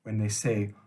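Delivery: usual speaking voice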